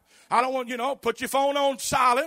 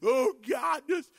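mock despair; 3 dB quieter than usual. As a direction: fade into a pitiful whimper. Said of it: "I mean, go".

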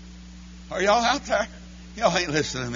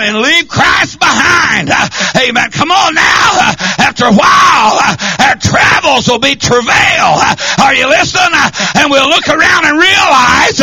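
loud, high shouted sermon cadence; hollering revival preaching; full-force preaching; 7 semitones higher, 12 dB louder than usual. Acted. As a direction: drive each phrase home to the congregation.

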